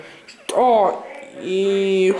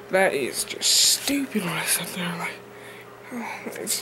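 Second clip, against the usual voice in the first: whispered aside; 3 semitones lower, 8 dB quieter than usual. Real, not acted. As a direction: drop to a whisper. Cringing at own old vlogs.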